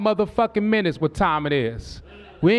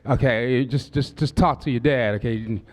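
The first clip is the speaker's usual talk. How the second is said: mock soothing dad; 7 semitones lower than usual. Father coaxing daughter.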